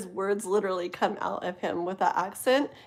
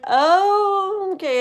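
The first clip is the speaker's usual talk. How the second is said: mock surprise; 11 semitones higher, 9 dB louder, much slower than usual. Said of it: "I'm like".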